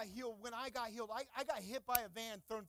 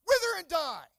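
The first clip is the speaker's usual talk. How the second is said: shouted command; 6 semitones higher, 14 dB louder than usual. Acted. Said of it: "get that knife hand out".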